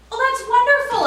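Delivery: high, loud gushing shop voice; gushing saleswoman. Retold or imitated. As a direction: greet them with big fake cheer. Saleswoman greets birthday customer.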